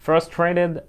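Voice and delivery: Frustrated said slowly and clearly, sound by sound; careful pronunciation. Shown as a demonstration. Teacher models Frustrated for students, clear careful pronunciation. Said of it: "خلي بالك من النطق".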